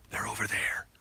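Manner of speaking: voiceless whisper; hushed, whispered aside. Real, not acted. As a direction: point them out in a whisper. Secretly pointing out people nearby.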